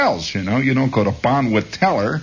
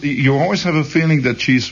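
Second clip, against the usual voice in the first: ordinary talk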